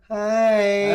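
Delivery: high, loud, camp greeting; mock camp nurse. Nurse greeting the patient as he walks in, mock camp voice.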